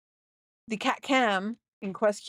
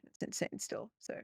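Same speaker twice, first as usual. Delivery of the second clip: whisper; 15 dB quieter than usual. Real, not acted. Muttered aside after donation total.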